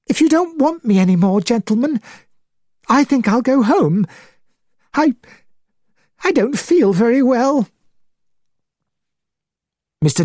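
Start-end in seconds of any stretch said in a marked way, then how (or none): 0.0-7.7 high, faltering, shocked gentleman; distressed and hesitant, pitched well up; shaken. A narrator's reading. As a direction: excuse yourself weakly in a high voice. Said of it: "He suddenly looked old and shaken".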